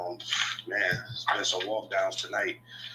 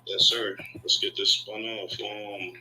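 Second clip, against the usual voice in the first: ordinary delivery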